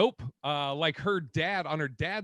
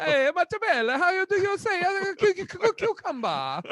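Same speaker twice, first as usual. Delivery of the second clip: much higher, louder hammed-up Italian accent; mock Italian accent; 13 semitones higher, 4 dB louder than usual. Mocking her on the cooking show in hammed Italian. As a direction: ham up an Italian searching for cucumber. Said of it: "let me do, uh, Sean's, uh, Italian".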